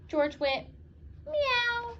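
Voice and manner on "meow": squeaky high cat meow; playful high meow. Acted. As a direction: meow like a kitten. Dog makes cat sound.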